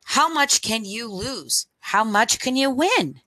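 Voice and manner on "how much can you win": ordinary delivery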